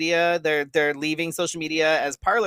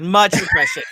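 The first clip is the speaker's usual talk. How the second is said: sarcastic; 5 semitones higher, 6 dB louder than usual. Acted. Sarcastic jab.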